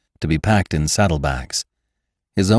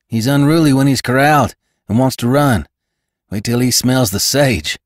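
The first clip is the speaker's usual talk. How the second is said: higher-pitched, lively lad; stable boy; 5 semitones higher, 3 dB louder than usual. Acted. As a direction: pipe up eagerly about the horse. Voices the stable boy Jerd.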